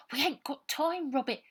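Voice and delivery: put-on high, loud cockney girl; a scrawny orphan hurrying them; street girl Aggie. A narrator's reading. Aggie hurrying Robert, high urgent cockney girl.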